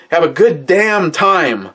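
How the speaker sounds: corrected take, with the word "damn"; worked-up grandpa, corrected. Retold or imitated. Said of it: "He didn't say fuck".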